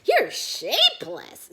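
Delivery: shrieking schoolyard taunt; mean Little Triangle. Acted. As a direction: sneer it out high and loud. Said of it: "says Little Triangle".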